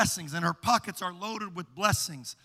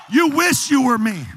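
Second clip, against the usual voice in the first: higher, shouted repeat; louder shouted rebuke; 5 semitones higher, 15 dB louder than usual. Acted. Believer shouting the same line louder at the devil. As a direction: shout it the second time. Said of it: "what you gotta do is just say".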